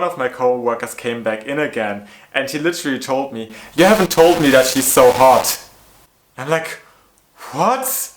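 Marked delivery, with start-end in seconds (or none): 3.7-5.6 much louder, mock-excited coworker; hammed-up loud ogling quote; mock loud leer. Coworker quoted, leering remark. 7.5-8.1 very high, disbelieving what; incredulous squeak of disbelief; stunned what. Retold or imitated. Her stunned reaction retold.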